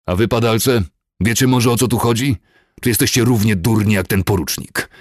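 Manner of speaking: loud, high angry interrogation; enraged. A narrator's reading. Furious commander turns on the captives, enraged. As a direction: demand an answer, insulting them in rage. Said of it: "z oczami nabiegłymi krwią".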